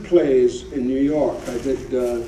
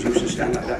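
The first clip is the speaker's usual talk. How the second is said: normal talking voice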